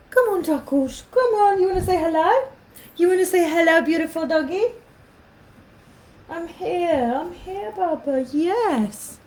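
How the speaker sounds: high, coaxing pet voice. Real, not acted. Vlogger coaxing her dog Takush to greet viewers in baby talk. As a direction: coax the dog to say hello, sweetly.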